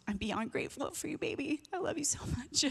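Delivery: soft and tender, real gratitude; tender thanks. Real, not acted. Mother thanking her son from the stage, softly and tenderly. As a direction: speak it softly to your son like a hug.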